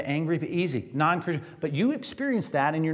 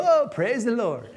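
mock joy; 6 semitones higher, slower than usual. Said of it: "nobody's gonna go".

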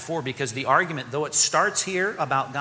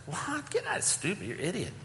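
scornful; 5 semitones higher, 8 dB quieter than usual. Acted. Voicing the mocking world.

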